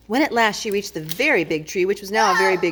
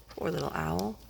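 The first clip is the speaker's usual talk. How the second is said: plain reading voice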